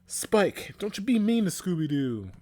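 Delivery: high, loud telling-off; protective, high-pitched reproach; indignant scolding. A narrator's reading. Xander scolds Spike for the dog.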